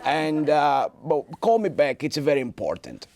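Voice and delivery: put-on softened Italian-accented father laying on the guilt; Italian father, sweet then insistent; father switching to guilt on voicemail, Italian accent; guilt-tripping Italian dad. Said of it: "with that accent".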